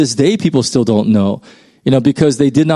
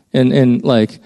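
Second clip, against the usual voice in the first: ordinary talk, nothing marked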